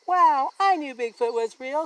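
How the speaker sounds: put-on excited kid's cry. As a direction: shout it with glee. Bob thrilled at note.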